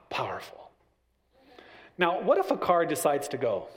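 usual speaking voice

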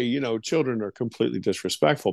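normal talking voice

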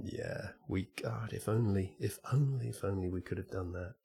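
breathy near-whisper, barely voiced, wistful; hushed inner thought. Reading Neil's tweet, a hushed longing thought to himself. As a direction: let it slip out under your breath like a private wish.

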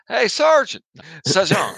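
loud, high Southern drawl calling for the sergeant; Southern soldier calling out to his sergeant; southern accent. Acted. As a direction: holler it across the room like a Southern soldier. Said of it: "Got my southern accent".

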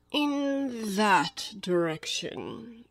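extremely slow, elongated cat voice; Cheshire Cat. Acted. The cat points Alice one way, languid. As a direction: stretch every word lazily.

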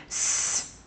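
quiet, long s; whispered s hiss; soft, steady s like a snake. Shown as a demonstration. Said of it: "Do it with me".

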